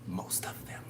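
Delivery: half-secret whispered add-on; whispered aside. Real, not acted. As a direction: drop to a soft whisper.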